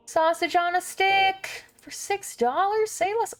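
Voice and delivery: high, delighted squeal of a find; excited. Real, not acted. Thrilled at finding a product.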